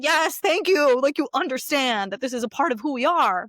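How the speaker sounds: delighted relief. Retold or imitated. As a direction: gush with relief. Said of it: "I was like".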